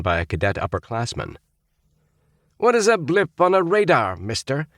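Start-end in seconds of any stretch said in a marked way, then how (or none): 2.6-4.7 demanding. A narrator's reading. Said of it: "demanded Roger".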